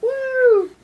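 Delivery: very high loud whoop; cheer. Real, not acted. Cheering for baby.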